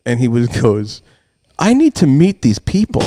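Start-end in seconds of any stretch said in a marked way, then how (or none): 1.6-3.0 imitating incredulous son. Son's punchline to dad, acted out.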